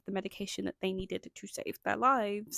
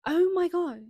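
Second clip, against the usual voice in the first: exasperated; 9 semitones higher, 10 dB louder than usual. Real, not acted. Exasperated at lighting change.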